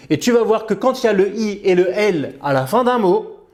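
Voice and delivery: usual speaking voice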